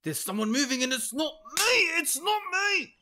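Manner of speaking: high, loud panicked outburst; panicked yell. Real, not acted. Player alarmed by someone moving, panicked shriek. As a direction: voice shooting up into a squeal. Panics at movement in horror game.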